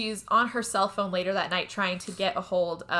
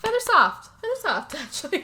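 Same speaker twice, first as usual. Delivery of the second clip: teasing; 8 semitones higher, 4 dB louder than usual. Retold or imitated. Retells George teasing about John.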